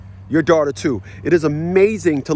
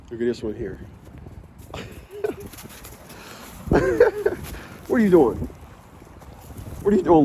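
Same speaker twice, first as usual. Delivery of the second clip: talking to dogs; 3 semitones higher, 3 dB quieter than usual. Real, not acted.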